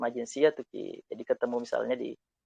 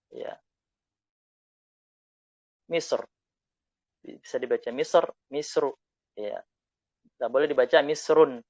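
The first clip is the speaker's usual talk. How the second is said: ordinary delivery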